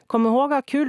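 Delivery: called out loud and high after him; dad calling out. A narrator's reading. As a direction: shout it after the kid as he runs off. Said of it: "ropade pappa efter honom".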